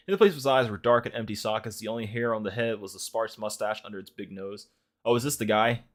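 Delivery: ordinary talk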